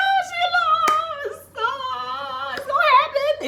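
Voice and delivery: very high, giddy gossip voice; squealing over a budding romance. Retold or imitated. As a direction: squeal it like you just spotted a romance. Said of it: "I was like".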